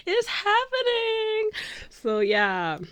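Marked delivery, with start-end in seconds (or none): 0.1-1.5 very high, drawn-out squeal; ecstatic squeal. Retold or imitated. Fan as the crowd goes wild, ecstatic squeal. Repeats it, escalating.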